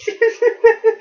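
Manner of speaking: high, wheezy giggling fit; real high-pitched laughter; helpless giggle. Real, not acted. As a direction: crack up mid-story. Cracks up at own story.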